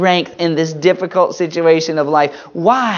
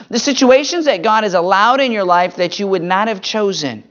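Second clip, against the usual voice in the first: usual speaking voice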